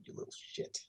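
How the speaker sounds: hushed, breathy scolding aside; whispered insult. Pretend. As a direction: mutter it under your breath. Scolding younger self, sudden aside.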